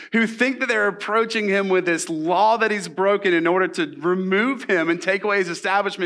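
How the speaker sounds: usual speaking voice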